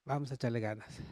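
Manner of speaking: calm him with soft tenderness; tender reassurance. Shown as a demonstration. Wife calming her angry husband, soft and tender. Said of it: "a decir".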